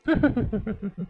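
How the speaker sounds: a high, soft sly chuckle. Acted. Husband gloating after fooling his wife, soft sly chuckle. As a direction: chuckle to yourself over the trick.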